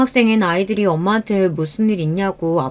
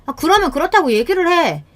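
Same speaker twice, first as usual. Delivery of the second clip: much higher, exasperated retort; irritated fault-finding; 9 semitones higher than usual. Acted. Wife picking a fight with husband, irritated. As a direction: scold in a high, annoyed voice. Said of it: "말도 안 되는 트집을".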